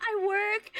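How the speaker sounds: words squeezed out mid-laugh; laughing through words. Retold or imitated. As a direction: giggle while repeating it.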